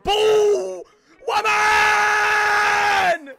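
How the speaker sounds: high, drawn-out goal scream; elated high-pitched holler. Real, not acted. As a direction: scream in celebration, holding the vowels. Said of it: "I'm so sorry for screaming".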